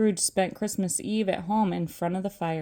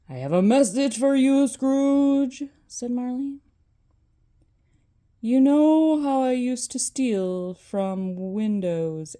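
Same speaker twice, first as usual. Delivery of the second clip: ghostly voice; 3 semitones higher, 4 dB louder than usual. Acted. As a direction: speak like a weighty ghost.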